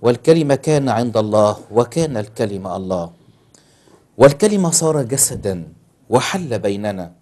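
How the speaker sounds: loud, emphatic Bible recitation; scripture declamation. Acted. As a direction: proclaim the verse forcefully to an audience. Recites scripture from memory or book.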